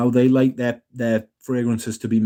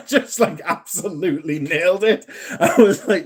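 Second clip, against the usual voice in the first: talking through real laughter, higher pitch; laughing; 5 semitones higher than usual. Real, not acted. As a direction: crack up while telling it. Cracking up at retold reply.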